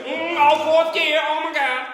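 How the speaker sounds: loud, high strained shout over the revs; revving manual car. Acted. Comic voicing a woman shifting into fourth, loud high revving. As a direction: yell it like an engine climbing through the gears. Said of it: "like she's driving a manual car".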